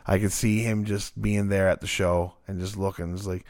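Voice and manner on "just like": ordinary talk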